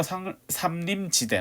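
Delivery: false start on "상-", then "삼림지대"; tongue-tripped. Real, not acted. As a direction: stumble into "상-", break off and correct to "삼림지대". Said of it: "나 이거 맨날 발음이 안 되더라".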